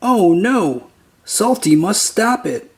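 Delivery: ordinary delivery, nothing marked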